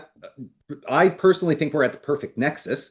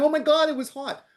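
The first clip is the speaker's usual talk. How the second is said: high, mock-pained kid's cry; a child whining after touching the stove; 10 semitones higher than usual. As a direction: squeal it like a burnt child. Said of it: "they start crying".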